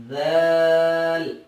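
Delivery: long, slow, loud ذال; loud drawn-out ذال; drawn-out letter name. Shown as a demonstration. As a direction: stretch ذال out slowly, like a Qaida recital. Teacher models letter for child.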